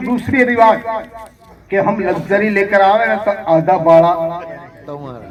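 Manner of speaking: usual speaking voice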